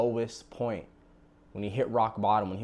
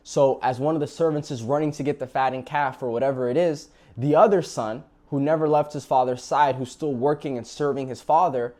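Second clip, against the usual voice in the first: excited retelling; 5 semitones higher, 8 dB louder than usual. A narrator's reading. Building excitement in narration.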